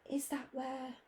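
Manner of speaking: whispering. A narrator's reading. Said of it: "whispered Professor McGonagall".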